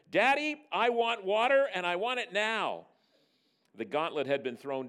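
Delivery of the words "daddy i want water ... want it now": put-on high, loud little-girl demand; defiant screech. Daughter screeching from the bedroom, defiant demand. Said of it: "defiant little voice screeched".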